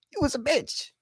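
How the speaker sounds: real high break as the voice fails; voice giving out. Real, not acted. Teasing the bus riders when the voice goes out, squeaky crack. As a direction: voice cracking up into a thin, soft squeak. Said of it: "I heard my voice go out a little bit there".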